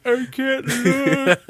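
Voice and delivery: high slow squeal of a squeamish friend; high drawn-out squeamish wail; scared friend. Retold or imitated. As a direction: squeak it out, hiding your eyes. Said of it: "he's like".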